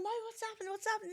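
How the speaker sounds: high, worried retold question; alarmed mum. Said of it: "I was going".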